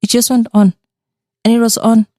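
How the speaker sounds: loud, high, insistent; excited. Real, not acted. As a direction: stress the disbelief. Excited ghost tale, louder.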